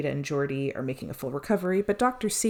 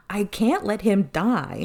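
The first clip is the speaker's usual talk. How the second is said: slower, louder, higher plea, a touch of retold desperation; pleading cry; 5 semitones higher, 5 dB louder, slower than usual. Podcaster retelling Doctor C refusing to let Picard die, mock desperate plea. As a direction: push it out slowly as if begging for a life.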